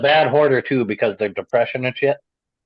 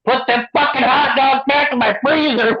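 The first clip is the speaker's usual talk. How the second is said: yelling across the room; 12 semitones higher than usual. Retold or imitated. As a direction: yell it across the house. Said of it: "yells across the house".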